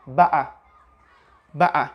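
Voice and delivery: "better" with a catch in the throat for the T; Cockney "better", T swallowed; Cockney glottal T. Shown as a demonstration. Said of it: "This accent is called Cockney accent".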